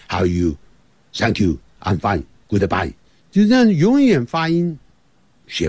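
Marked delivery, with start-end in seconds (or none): 0.1-2.9 quick Mandarin-accented English; mock Chinese-accented English phrases; Chinglish accent. Shown as a demonstration. Teacher mimicking Chinglish for learners, Mandarin accent. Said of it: "也就是中式英文".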